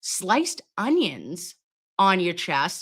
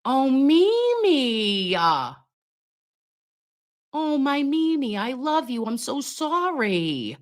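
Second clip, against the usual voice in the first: tender cooing; 6 semitones higher, slower than usual. Real, not acted. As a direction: coo to her like a beloved pet. Cooing to her pet Mimi.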